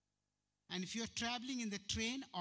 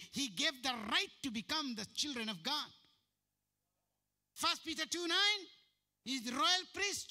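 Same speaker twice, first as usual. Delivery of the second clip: loud, raised declaration of the verses; fervent scripture quote; 8 semitones higher, 5 dB louder than usual. Retold or imitated. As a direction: proclaim the verses with fire. Quoting scripture to the priest.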